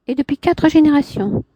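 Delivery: a hint of a proud woman; Clémentine's voice. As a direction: lift into her haughty tone. Man voicing woman in dialogue.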